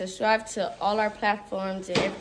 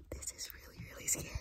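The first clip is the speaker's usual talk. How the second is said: whispering while hiding; 13 dB quieter than usual. Real, not acted. Hiding in closet during hide and seek.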